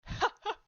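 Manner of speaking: loud, high mocking laugh; short burst of cruel laughter. A narrator's reading. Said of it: "continuing to laugh".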